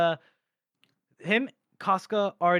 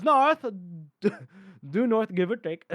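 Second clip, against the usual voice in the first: put-on Apu-like Indian accent; 2 semitones higher than usual. Longfoot giving directions, accidental Indian accent. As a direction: break into an accidental Indian accent, laughing midway. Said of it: "That was very Apu".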